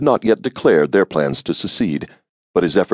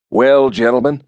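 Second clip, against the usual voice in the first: put-on flat nasal accent; 6 semitones higher, 4 dB louder than usual. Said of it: "in his flat nasal accent".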